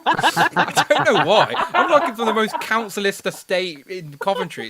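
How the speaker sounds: high, loud real laughter through words; laughing protest. Real, not acted. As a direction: protest helplessly while cracking up. Laughing at being teased about accent.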